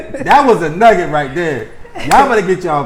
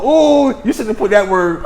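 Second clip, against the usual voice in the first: higher, long wincing "ooh"; mock cringe; 5 semitones higher than usual. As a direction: exaggerate the pain of hearing a misused word. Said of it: "Do you cringe like".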